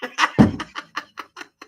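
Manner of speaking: high unvoiced wheezy laugh; cracked up after a silly bit; breathy giggle. Real, not acted. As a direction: laugh silently at your own joke.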